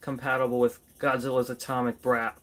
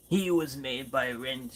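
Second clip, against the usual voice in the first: a failed attempt at an Australian accent; bad Australian accent; 3 dB quieter than usual. Voicing a toy in a badly done Australian accent. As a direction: try an Australian accent and miss. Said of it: "I can't even do an Australian accent".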